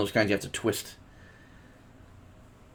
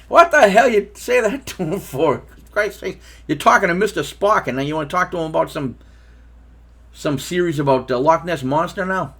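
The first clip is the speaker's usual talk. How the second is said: loud, raised-pitch mock telling-off; exasperated scolding; 6 semitones higher, 5 dB louder than usual. Father to son after he bothered Mister Spock, exasperated. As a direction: act out an incredulous dad's rant.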